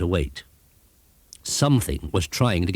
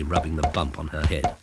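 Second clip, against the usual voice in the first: plain reading voice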